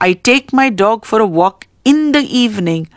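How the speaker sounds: "in the evening" set apart and stressed at the end. Shown as a demonstration. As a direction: put the weight on "in the evening". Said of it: "The highlighted words are in the evening".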